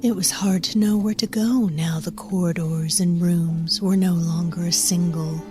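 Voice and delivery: ordinary delivery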